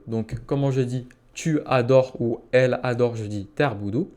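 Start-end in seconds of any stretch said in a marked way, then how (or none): none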